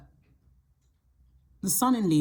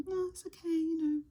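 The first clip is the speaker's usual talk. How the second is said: much higher, soft, timid voice; a tiny, soft, much higher voice that fades out as it gives in; meek people-pleaser voice; 13 semitones higher, 7 dB quieter, faster than usual. Pretend. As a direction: shrink away as you say it's fine. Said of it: "I don't know why I'm doing this voice".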